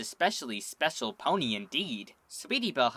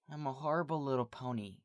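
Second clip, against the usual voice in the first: much softer, self-pitying; sulky mutter; 8 dB quieter, faster than usual. A narrator's reading. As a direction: drop it down, sulking. Sweetie sulking after outburst.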